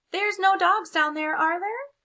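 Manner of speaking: high, loud worried question; nervous crayon. Acted. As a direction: ask with mock worry.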